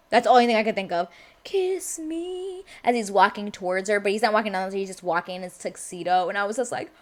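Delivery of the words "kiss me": high, soft, drawn-out croon; play-acted lovestruck plea; mock-romantic swoon. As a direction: coo it softly, high and dreamy. Repeats mock-romantic quote.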